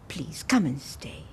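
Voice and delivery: slow, gentle whisper. Acted. Mother begging daughter home.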